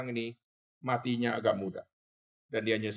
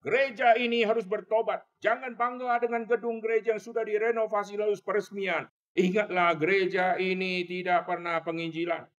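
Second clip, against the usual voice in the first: put-on old pastor shouting; 7 semitones higher than usual. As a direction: shout the warning from the pulpit. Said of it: "dia terus berteriak". (teriakan keras pendeta tua)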